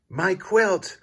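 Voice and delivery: high, alarmed cry. A narrator's reading. The quail sees his quilt stolen, alarmed high cry. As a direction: yelp as the dog snatches it. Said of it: "cries Quincy".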